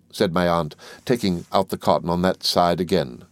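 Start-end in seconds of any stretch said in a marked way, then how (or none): none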